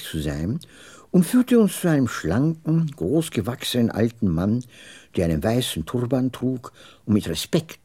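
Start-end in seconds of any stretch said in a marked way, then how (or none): none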